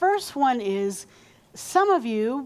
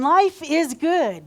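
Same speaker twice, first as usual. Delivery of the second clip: higher, louder and slow; louder, slower call to repeat; emphatic repeat; 5 semitones higher, 5 dB louder, slower than usual. Shown as a demonstration. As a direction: push it out to the room.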